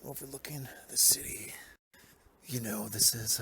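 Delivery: breathy whisper. Acted. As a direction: hush it down. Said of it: "I should whisper".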